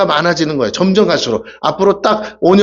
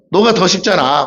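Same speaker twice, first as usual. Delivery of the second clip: ordinary talk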